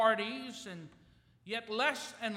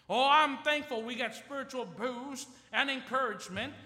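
normal talking voice

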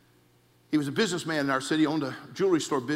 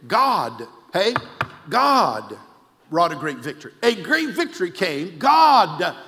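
full-force preaching; 5 semitones higher, 10 dB louder than usual. Acted. Preacher declaring victory to the congregation, building to a shout. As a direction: hammer each line harder than the last.

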